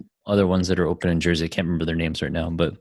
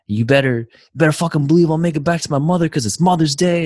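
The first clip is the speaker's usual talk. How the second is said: imitating an irate man, loud and much higher; mock a customer's raised-voice rant; retold yelling; 9 semitones higher, 5 dB louder than usual. Angry man ranting at the worker about getting to his mother, retold yelling. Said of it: "yelling and yelling".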